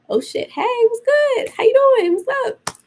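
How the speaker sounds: greeting the spirit after the wind answers, squeaky nerves; nervous squeaky greeting. Retold or imitated. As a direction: play it cool while startled to the core. Wind answers her call to spirit.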